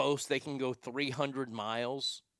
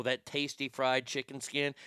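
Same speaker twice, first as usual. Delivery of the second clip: normal talking voice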